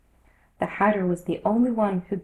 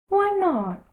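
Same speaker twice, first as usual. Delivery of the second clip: March Hare; 11 semitones higher, 4 dB louder than usual. A narrator's reading.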